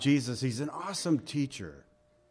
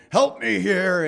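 louder and pushy, heart not in it; demanding help rather than asking; 5 semitones higher, 6 dB louder, slower than usual. Shown as a demonstration. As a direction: bark out the request like an order. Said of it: "more like demanding".

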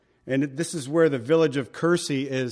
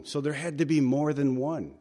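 ordinary talk, nothing marked

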